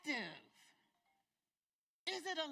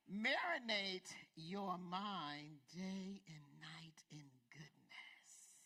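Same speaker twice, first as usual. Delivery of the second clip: slow, fading; 7 semitones lower, much slower than usual. Acted. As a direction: fade it down tenderly. Slow soft close.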